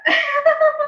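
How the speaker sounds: high, loud burst of laughter; a sudden real laugh, pitched high. Real, not acted. Laughs at being corrected.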